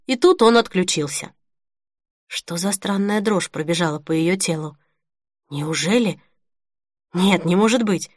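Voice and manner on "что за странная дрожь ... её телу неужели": ordinary delivery, nothing marked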